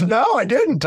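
high-pitched retold denial; amused denial. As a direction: quote her in a high, amused voice. Retold comic comeback after laughing.

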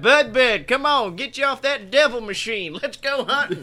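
a hint of a hunting father; gruff dad. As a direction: holler the kid off the console. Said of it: "Then his dad comes in".

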